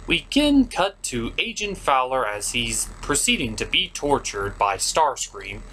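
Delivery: ordinary talk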